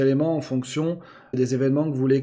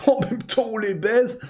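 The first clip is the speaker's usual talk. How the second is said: laughing; 6 semitones higher than usual. Real, not acted. Cheeky joke about viewers.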